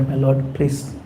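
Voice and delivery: ordinary talk, nothing marked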